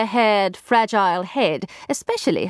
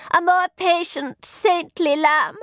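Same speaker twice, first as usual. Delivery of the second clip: high, slow adoring voice; devoted lady's maid; 9 semitones higher, slower than usual. Acted. Satin chiming in about her mistress, adoring. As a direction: dwell on the praise, pitched high.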